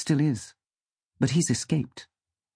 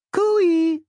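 very high, loud cooee; calling out; 6 dB louder than usual. A narrator's reading. First voice calls to Sam from the bucket, calling out. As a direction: sing out a long, high call. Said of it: "calls the first voice".

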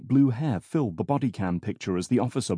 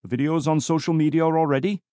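put-on calm North Wales accent, pitched up; 6 semitones higher, 3 dB louder than usual. A narrator's reading. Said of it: "answered calmly in his distinctive North Wales accent".

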